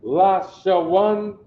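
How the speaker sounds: Lashawan drawn out slowly and loud, each syllable clear; slow demo pronunciation. Shown as a demonstration. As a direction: sound out Lashawan slowly for the class. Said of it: "You see that?".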